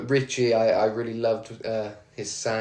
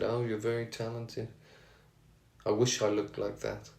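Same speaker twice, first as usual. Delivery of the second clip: put-on lightly done Birmingham accent, soft and quiet; 6 dB quieter than usual. Fan quoting [name]'s praise in Birmingham accent. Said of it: "It's my best Birmingham accent there".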